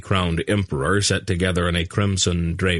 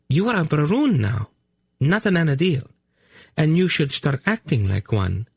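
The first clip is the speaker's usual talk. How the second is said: high, proper scolding niece; prim young woman; 8 semitones higher than usual. A narrator's reading. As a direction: correct him like a prim niece.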